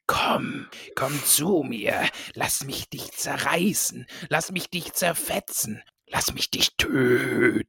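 slow, hushed, menacing murmur from nowhere; icy hateful voice. Retold or imitated. Podcaster reading the evil voice to co-host, slow and chilling. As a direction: creep out the listener with a cold, hating hiss. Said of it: "Eine Stimme erfüllt von eiskaltem Hass und diese sagt".

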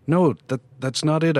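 high, rushed denial; panicked protest. A narrator's reading. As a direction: blurt it out in a panic.

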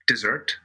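"Dessert" with a strongly sounded R; hard R. Shown as a demonstration. Actor demonstrating for the host, "Dessert" with a Minnesotan R. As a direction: hit the R in "Dessert". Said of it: "on my name and on the dessert".

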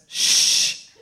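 stern librarian shush, breathy and unvoiced; library whisper. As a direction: hush a noisy reader, strict librarian. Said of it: "a very strict librarian who goes".

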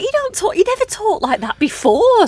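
high-pitched mock disbelief; incredulous. Retelling a reaction to mates' new accent, incredulous. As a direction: call out the fake act, voice shooting up.

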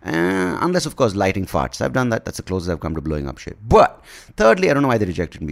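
normal talking voice

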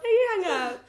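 giggly late night call. Pretend. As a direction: squeal it like a lovestruck teen. Mock lovers' phone goodbye.

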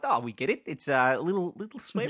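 a hint of a mock Kiwi accent; New Zealand accent. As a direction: lightly put on New Zealand voice. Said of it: "They were, they were like".

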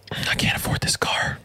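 imitated sheepish near-whisper; hushed confession. Buyer phoning the salesman to back out, hushed. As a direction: drop to a soft, voiceless admission.

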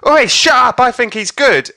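high, loud imitated shout; hammed-up punter defending the act; crowd heckler. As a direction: shout down the chanters. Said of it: "this half of the crowd goes".

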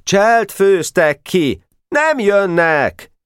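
put-on high-pitched, drawn-out boyish cries; stretched, high cries of alarm; excited outcry. A narrator's reading. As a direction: call it out high and slow, full of excitement. Excited boys' cries in story.

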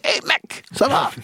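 high, breathy whispered "Hey, mec, ça va?"; lightly mocking a friend doing the voice; mock buddy greeting. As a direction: squeak out a pal's casual hello. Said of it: "il fait".

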